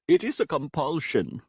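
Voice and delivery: lightly voiced German accent; a hint of a stern German librarian; mild German accent, solemn. A narrator's reading. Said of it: "the German librarian said".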